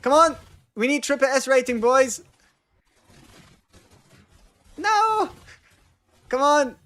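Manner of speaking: shouting. Real, not acted. Cheering on the game team.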